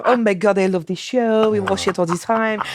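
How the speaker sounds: a bad, hammed-up French accent; terrible French accent. Retelling the French fan's praise in a bad French accent. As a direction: fake a French fan gushing. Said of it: "I know that was a terrible".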